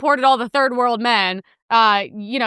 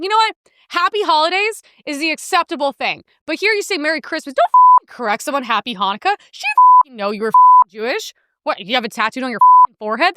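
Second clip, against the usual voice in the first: angry rant; 3 semitones higher, 3 dB louder than usual. Retold or imitated. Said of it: "But I was like".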